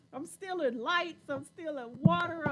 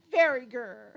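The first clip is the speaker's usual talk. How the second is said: mock angry growl; 4 semitones lower, 9 dB louder than usual. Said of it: "very angry".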